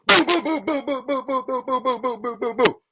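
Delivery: sing-song taunting boo boo boo, fading out; mock booing. Taunting the rival Pumpkin with a fading boo chant. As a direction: tease her with a childish boo chant.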